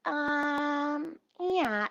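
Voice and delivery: mock hesitation before giving in; hesitant server. Server asked for a spare cup, mock hesitant. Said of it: "So she's like".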